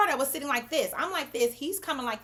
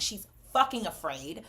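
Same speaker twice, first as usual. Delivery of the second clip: usual speaking voice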